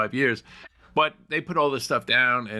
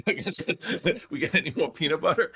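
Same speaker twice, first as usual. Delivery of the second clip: higher-pitched, laughing through the words; 7 semitones higher than usual. Real, not acted. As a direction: ask it through real laughter. Joking aside, laughing as speaking.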